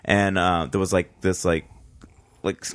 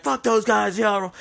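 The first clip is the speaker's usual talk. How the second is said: mock yell; 11 semitones higher, slower than usual. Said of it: "kicking and screaming".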